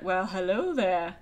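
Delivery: normal talking voice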